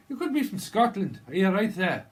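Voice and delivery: loud, high mock Scottish-Irish accent; Scottish accent gone Irish. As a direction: belt out a muddled Scots-Irish accent. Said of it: "no, it's Irish".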